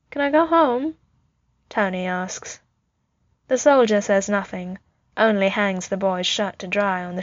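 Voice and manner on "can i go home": put-on high child's voice, lifted well up; little boy. A narrator's reading. Tony asks the soldier to go home, high and childlike. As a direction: plead lightly as a small boy.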